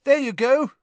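high, loud and gleeful; happy yell. A narrator's reading. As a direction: shout it cheerfully over the noise. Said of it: "Ron yelled happily".

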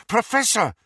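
urgent shout across the room. A narrator's reading. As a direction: call out sharply in alarm. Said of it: "Harry shouted".

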